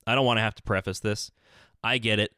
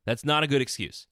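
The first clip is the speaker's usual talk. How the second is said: usual speaking voice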